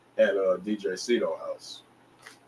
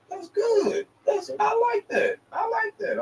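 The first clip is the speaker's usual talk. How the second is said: much higher, strained, crying-out impression of the promoter; mock high praise; slower than usual. Promoter hearing the song, much higher mock excited voice. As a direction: squeal it out like an overexcited fan.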